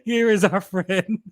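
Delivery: slow, laughing showman announcement; welcoming the guest onto the stream, slow and grand; drawn-out host intro. Real, not acted. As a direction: stretch it out like a game-show host, giggling.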